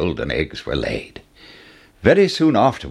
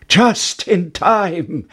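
high, drawn-out cry of relief; triumphant cry; 10 semitones higher, much slower than usual. A narrator's reading. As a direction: cry it out slowly, pitched high. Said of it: "cried Jack".

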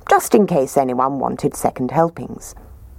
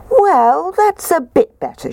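put-on much higher, light little girl voice; high girlish voice; 14 semitones higher, 3 dB louder than usual. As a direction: voice Goldilocks as a small girl, pitched high. Goldilocks voiced in the story.

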